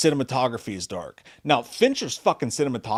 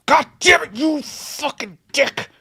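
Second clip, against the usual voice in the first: much higher, yelled, slowing at the end; mock angry outburst; 4 dB louder, slower than usual. Real, not acted. Yelling at Jay for going to pee. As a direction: fake fury at the cohost for leaving.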